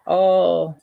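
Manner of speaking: ordinary talk, nothing marked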